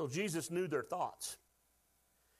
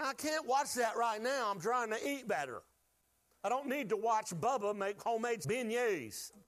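higher, mock-exasperated self quote; half-hammed protest to the TV; 6 semitones higher than usual. Preacher quoting himself refusing food shows, mildly higher. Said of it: "I'm like".

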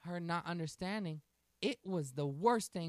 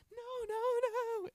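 put-on very high, loud panicked child's no; panicked little girl; 6 dB louder, much slower than usual. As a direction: shriek no like a scared toddler. Terrified child refuses help.

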